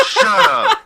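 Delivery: high, loud laughing scold; cracking up as you say it; laughing shut up. Real, not acted. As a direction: burst out laughing at him.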